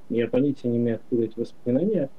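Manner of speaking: normal talking voice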